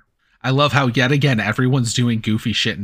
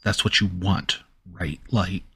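ordinary talk, nothing marked

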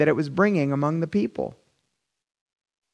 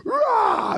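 very high wordless snarl of rage; hammed-up angry "rah"; mock retaliating roar. Spouse turns around and fires back, mock rage. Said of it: "turn around and go".

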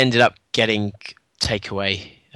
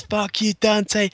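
mock defiant taunt; 10 semitones higher, slower than usual. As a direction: toss off the defiant sign-off in a high voice. Repeats the re-enacted taunt.

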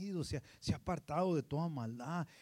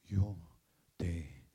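lower, slowing on yo te vi; tender divine voice; 6 semitones lower, 3 dB louder than usual. Acted. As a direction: let the words fall gently. God's words, dropping low. (voz tierna y grave)

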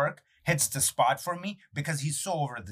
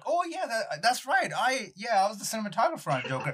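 humble Larry; 8 semitones higher than usual. Retold or imitated. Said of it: "And he's like".

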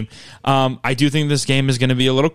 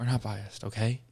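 quiet; 3 semitones lower, 12 dB quieter than usual. Pretend. Repeated joke, said softly.

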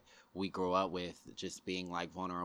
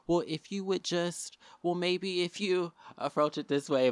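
much higher, slow, prissy imitation of onlookers; mock gentle advisor; 11 semitones higher, 5 dB louder than usual. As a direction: mimic the people lecturing the woman. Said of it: "be like".

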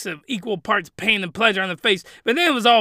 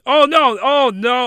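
loud, high dismayed oh no; sudden alarm at a mistake; real dismay; 4 semitones higher, 7 dB louder than usual. Real, not acted. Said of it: "I skipped".

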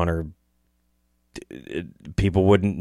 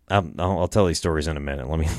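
normal talking voice

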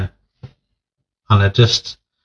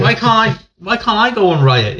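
very high mock whine of a kid; pleading child; 11 semitones higher than usual. Grounded boy begs mum to let him join the riot, high-pitched whine. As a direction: mimic a thwarted child, voice way up high.

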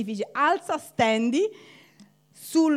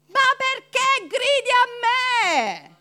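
God answering; 10 semitones higher, 7 dB louder, slower than usual. Retold or imitated. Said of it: "Gli dice".

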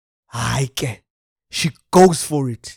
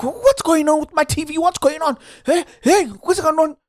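much higher, indignant shouting at the TV; outraged disbelief; 12 semitones higher than usual. Retold or imitated. Feed cuts off mid-hunt, much higher outraged yelling at the TV. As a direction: yell at the screen in disbelief.

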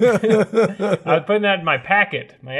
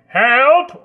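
loud, high yelp for help; mock cry for help; 9 semitones higher, 7 dB louder than usual. Testing an accent in a lion's den, mock cry for help. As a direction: call out in pretend peril.

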